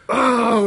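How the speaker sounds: loud, high groan of frustration; exasperated groan. Real, not acted. Ranting about the game.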